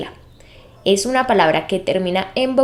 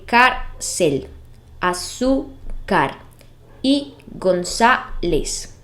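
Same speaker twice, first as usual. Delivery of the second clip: slow example words; 2 semitones lower, 3 dB quieter, much slower than usual. Shown as a demonstration. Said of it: "tiene el acento en la sílaba".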